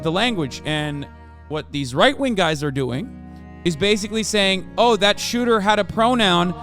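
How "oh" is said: usual speaking voice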